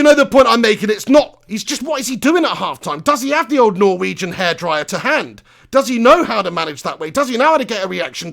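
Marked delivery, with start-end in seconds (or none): none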